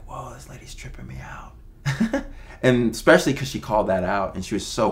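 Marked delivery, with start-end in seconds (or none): 0.1-1.5 breathy whispered aside, voice dropped right off; retold whisper. Retelling his shocked reaction. 1.6-2.6 whispered laugh. Retold or imitated. Laughs after whispered reaction.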